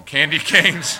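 laughs out the words candy canes; laughing. Retold or imitated. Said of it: "I'm going like this".

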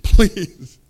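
loud, exaggerated pleading; hammed-up begging. Captain falling at Elijah's feet, hammed-up pleading. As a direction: beg loudly in mock desperation. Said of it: "he falls at the feet of Elijah".

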